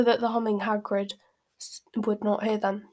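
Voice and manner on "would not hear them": usual speaking voice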